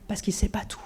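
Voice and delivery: a hushed aside for the congregation; sly whispered jab; conspiratorial whisper. Acted. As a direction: whisper it like a secret against the devil. Mocking the devil, sermon aside.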